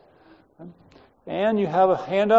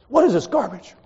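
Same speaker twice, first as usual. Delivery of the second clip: higher, louder burst of disgust; mock outrage; 5 semitones higher, 7 dB louder than usual. Chairman throws a failed test at a student, mock outrage. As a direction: snap it like an outraged professor.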